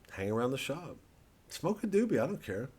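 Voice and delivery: usual speaking voice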